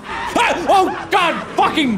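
high-pitched shriek into cursing; startled scream. Real, not acted. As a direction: yelp and swear in fright. Jump scare in the game.

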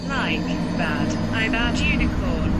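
loud, put-on cross voice; angry yell. Offended figure answering the stupid unicorn insult, angry yell. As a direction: shout it back in a huff.

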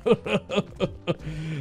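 long staccato chuckle; evenly pulsed laughter; chuckling. Pretend. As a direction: laugh it off as nothing to worry about. Cop laughs off the intimidation.

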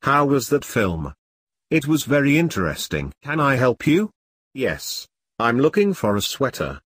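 ordinary talk, nothing marked

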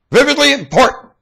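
very high and loud; squealing loud emphasis; sudden high shout. Real, not acted. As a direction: leap up on vividly.

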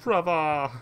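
put-on high, drawn-out "Brother!" in a weird accent; mock the odd accent with a dropped final r. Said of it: "like, a really weird accent".

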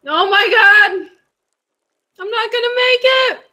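panicked. Real, not acted. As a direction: panic as time runs out. Racing the in-game clock.

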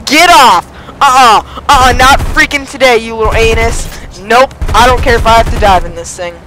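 loud, high, indignant shouting; heated yelling. Real, not acted. Gamer telling enemy to get off, heated yelling. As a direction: yell the attacker off your tail.

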